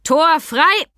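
loud, high shout with open pride, rising at the end; proud call. A narrator's reading. Sam announces Mika's arrival to the hall, proud call. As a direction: call it out proudly, lifting the last word. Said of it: "rief mit unverhohlenem Stolz in der Stimme".

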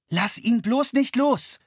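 slow, high, urgent plea; urgent pleading. A narrator's reading. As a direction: beg her not to let go. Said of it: "beschwor er sie".

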